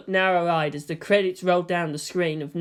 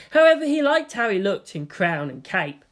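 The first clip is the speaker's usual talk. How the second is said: ordinary delivery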